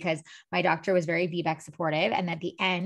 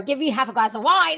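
frantic plea; 6 semitones higher, 7 dB louder than usual. Retold or imitated. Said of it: "I'm like".

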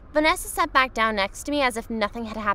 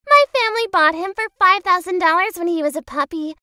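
put-on bratty girl voice; 6 semitones higher, 5 dB louder than usual. Vanessa voiced bragging.

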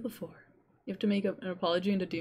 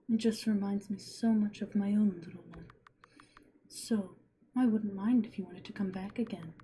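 hushed, wistful witch; tender whispering witch; 4 dB quieter than usual. Acted. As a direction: whisper it like a lonely mother.

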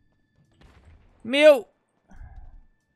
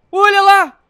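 high, very loud shout; excited shout; 7 semitones higher, 13 dB louder, slower than usual. Real, not acted. Player pointing out the falling meteors, excited shout. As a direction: yell it out in alarm.

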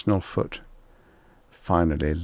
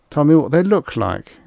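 higher, louder sudden demand; 8 semitones higher, 6 dB louder than usual. A narrator's reading. Extroni questioning the hunter about the beasts, sudden. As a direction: put the question abruptly, raised in pitch. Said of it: "he said suddenly".

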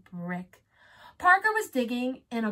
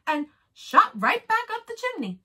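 high, loud pained reading; howling narration; 13 semitones higher, 7 dB louder than usual. A narrator's reading. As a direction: read it like a howl. Wolf burned, narration howls.

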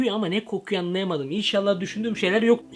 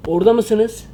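louder, higher call into the dark; 5 semitones higher, 8 dB louder than usual. Real, not acted. Calling out to an empty room, loud and raised. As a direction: call out to see if anyone's there.

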